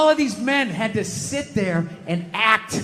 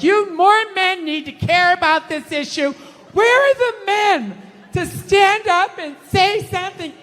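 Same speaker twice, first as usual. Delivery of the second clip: mock women's complaint; 5 semitones higher than usual. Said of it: "They keep coming to us like".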